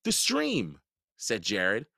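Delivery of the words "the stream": put-on much higher boy voice; boy answering while fleeing; quick answer. A narrator's reading. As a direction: snap the answer.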